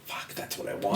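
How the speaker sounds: whispered retold realization; hushed longing. Recalling seeing sober friends living free, hushed longing. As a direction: say it under the breath, as a private thought.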